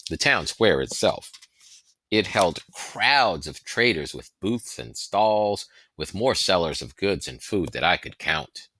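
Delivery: usual speaking voice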